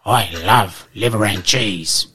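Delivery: lightly voiced big dog, slow; a hint of a slow, dopey Rottweiler. Rottweiler answers the poodle.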